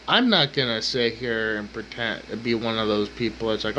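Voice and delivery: ordinary talk, nothing marked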